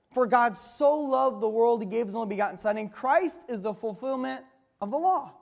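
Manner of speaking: ordinary delivery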